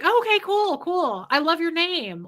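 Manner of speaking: high, loud, delighted compliment; gushing friendliness. Acted. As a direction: make the server feel liked. Models gushing compliment to server.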